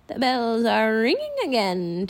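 a hint of a creaky old woman; slow, lightly creaky old crone. A narrator's reading. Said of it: "she said in a creaky voice".

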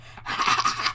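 breathy mock cackle; mimicked gremlin cackle. Gremlin as the window rolls up, mimicked cackle. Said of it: "the gremlin's like".

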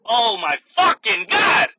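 slow, loud, exasperated outburst; mock exasperated shout. Retelling the bagging quarrel with the customer, mock exasperated shout. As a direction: draw it out loudly in hammed-up exasperation.

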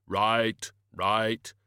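very slow, booming, grave voice; Death's voice. Acted. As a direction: drag each word out like a tomb.

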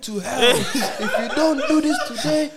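loud, slow, laughing preacher yell; doomsday street preacher. Retold or imitated. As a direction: yell it like a roadside preacher with a mic. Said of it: "All the time it's just shouting and that".

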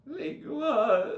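high, slow cracking squeak. Retold or imitated. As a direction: mimic a voice breaking high. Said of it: "my voice just broke".

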